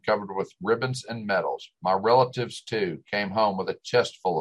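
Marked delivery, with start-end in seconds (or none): none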